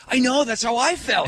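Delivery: put-on a panicked teen commiserating; panicky high agreement. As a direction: squeal it out in sympathy. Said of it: "I said".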